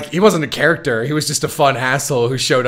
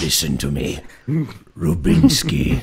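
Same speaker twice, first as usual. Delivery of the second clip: dopey deep voice; 7 semitones lower than usual. Retold or imitated. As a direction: drop into a deep goofy villain growl. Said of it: "and I'm like".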